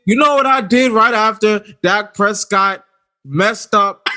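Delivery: usual speaking voice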